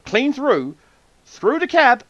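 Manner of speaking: excited. Real, not acted. Excited telling of arrow shot.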